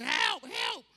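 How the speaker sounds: shouted cry for help; hollering for help. Retold or imitated. Stuck father shouting help to his son, hollering. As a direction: yell like you're stuck to the bed. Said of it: "I'm hollering".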